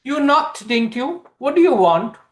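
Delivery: put-on a confident girl challenging a stranger at her door; girl at the door boldly questioning Kapil; bold young woman. A narrator's reading. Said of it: "she is also bold and confident".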